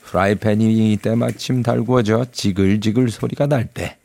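normal talking voice

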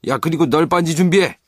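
high, loud command; barked order. Acted. Hook orders the plank readied, loud command. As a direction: bark it at the crew.

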